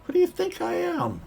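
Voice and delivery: put-on very high indignant old-timer; old prospector. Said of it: "like an old prospector".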